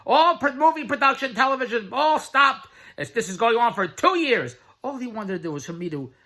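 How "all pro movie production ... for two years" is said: higher and louder whining complaint; mock whiny friend. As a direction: ham up the friend's despair.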